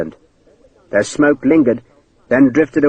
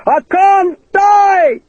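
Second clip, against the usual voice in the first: much higher, drawn-out slow yell; triumphant shout; 4 dB louder, much slower than usual. Acted. Hakeswill yells up at Sharp from the snake pit, triumphant slow shout. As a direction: crow your victory from the pit. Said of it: "Hakeswill called again".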